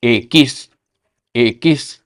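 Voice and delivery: ए किस as Spanish "equis"; Spanish letter name. Shown as a demonstration. Teacher to students, careful Spanish letter name. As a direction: model ए किस clearly. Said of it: "x को क्या कहते हैं".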